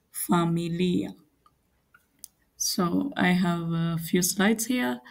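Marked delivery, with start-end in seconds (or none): 0.1-1.1 familia in drawn-out syllables, fa-mi-LI-a; careful pronunciation model. Shown as a demonstration. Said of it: "listen to my pronunciation carefully".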